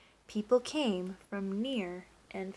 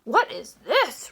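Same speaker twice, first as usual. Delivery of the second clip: loud, slow, higher angry roar; 5 semitones higher, 10 dB louder, slower than usual. A narrator's reading. Duke tasting the bad roll, roaring in anger. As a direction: bellow at the baker. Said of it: "roared the Duke".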